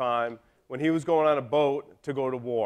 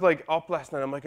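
normal talking voice

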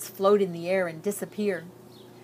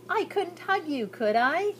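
1 tender mother; 9 semitones higher than usual. A narrator's reading. Mother reassures son.

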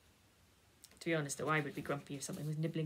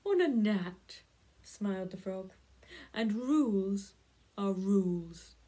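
loud, slow, smug laying down the rule; bossy frog; 7 dB louder than usual. Acted. Frog insists to the cat that rules are rules, loud and bossy. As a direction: lay it down like an unbending little boss. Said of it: "Frog, bossy".

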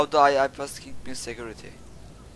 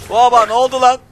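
loud surprised yelp, higher; startled shout; 9 semitones higher, 10 dB louder than usual. Real, not acted. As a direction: shout in real surprise at the screen. Sudden game event startles him. (şaşkınlıkla bağırarak)

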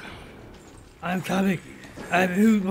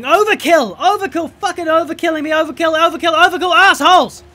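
screamed again and again at the game, much louder and higher; furious yelling; 9 semitones higher, 12 dB louder than usual. Real, not acted. Gamer killed yet again, furious shouting. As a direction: rage at being killed over and over.

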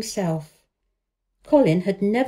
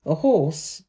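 ordinary delivery

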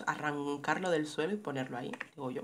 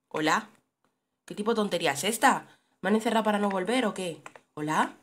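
calling out; 3 semitones higher, 7 dB louder than usual. Real, not acted. Calling out, trapped in game.

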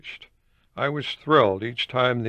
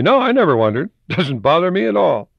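higher, louder dismissive editor; mock editor; 6 semitones higher, 6 dB louder than usual. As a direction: brush it off with a laugh. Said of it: "Editor was telling me".

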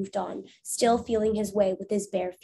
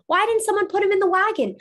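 higher, louder alarmed asking; dismayed question; 10 semitones higher, 6 dB louder than usual. Acted. Levi seeing the dog left behind, alarmed question. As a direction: demand to know who forgot. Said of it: "asked Levi".

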